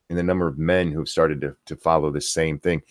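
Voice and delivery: normal talking voice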